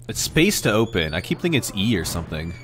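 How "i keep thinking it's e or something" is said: normal talking voice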